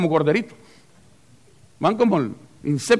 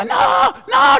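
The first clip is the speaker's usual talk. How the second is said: high, loud, frantic cry of "No, no!"; insect shouting a warning; 4 dB louder than usual. Acted. One insect screams at another flying to the light, high and loud. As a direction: shout the warning after a friend. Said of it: "le está gritando el otro".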